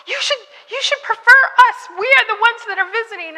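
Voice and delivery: high, fast, pleading insistence; panicked insistence. Retold or imitated. Pastor's wife insisting the visitors must be protected, high and rushed. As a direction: insist in a high rush that visitors come first. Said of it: "And she's like".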